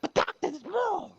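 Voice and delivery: very high, loud, cracking plea; high loud wail of a needy patient; desperate patient voice. Acted. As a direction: squeal it like a frantic little man. Pavlovich voiced in joke.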